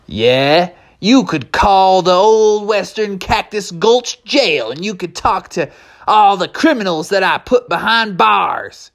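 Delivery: slow, high-pitched southwestern drawl; drawn-out southwestern cowboy voice. Acted. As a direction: boast like a southwestern sheriff. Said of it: "talking with a nice southwestern drawl".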